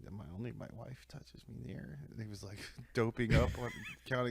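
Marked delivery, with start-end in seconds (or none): 0.1-2.0 much softer groggy voice; drowsy mumble. Retold or imitated. Drugged patient protesting to the nurse, groggy. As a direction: murmur it half asleep.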